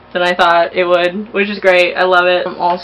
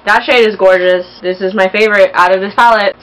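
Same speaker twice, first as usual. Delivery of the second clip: ordinary delivery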